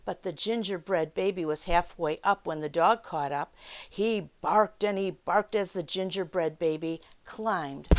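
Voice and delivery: unhurried read-aloud for little listeners; children's story time narration. A narrator's reading. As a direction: tell it like a picture-book storyteller.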